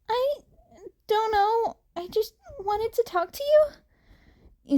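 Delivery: put-on high, earnest teen voice; Izuku, shy and friendly. A narrator's reading. Izuku explains himself to the stranger, high and earnest. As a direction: keep it light and eager.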